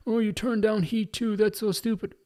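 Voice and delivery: high-pitched mock outrage, drawn out; mocking critics. Podcaster parroting fans angry about a turned-down role, mocking. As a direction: imitate whiny online complainers.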